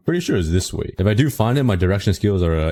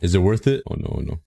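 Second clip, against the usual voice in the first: ordinary talk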